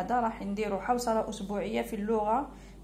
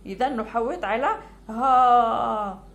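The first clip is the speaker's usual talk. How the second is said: slow teaching voice; 3 dB louder, slower than usual. Shown as a demonstration. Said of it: "هاوليك المد في الألف".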